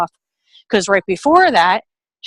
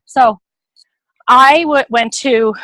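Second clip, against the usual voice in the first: normal talking voice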